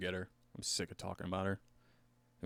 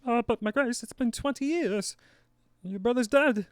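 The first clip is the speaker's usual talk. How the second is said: very high, loud messenger voice; hammed-up royal messenger; 13 semitones higher, 8 dB louder than usual. Messenger bringing raven news to Sansa, hammed-up high voice. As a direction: announce urgent news in a squeaky put-on voice.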